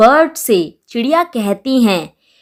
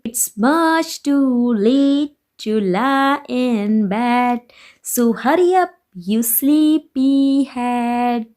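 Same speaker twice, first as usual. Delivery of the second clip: put-on slow, higher sing-song recitation of a children's rhyme; poem recitation; 4 semitones higher, much slower than usual. Teacher reciting the poem to her students, slow sing-song. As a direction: chant each line slowly and brightly for the class.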